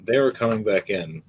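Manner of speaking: ordinary delivery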